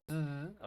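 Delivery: sustained buzzing z sound; long voiced z, like a buzz; vibrating Z. Shown as a demonstration. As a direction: hold the z so it vibrates. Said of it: "Tiene que existir esa vibración".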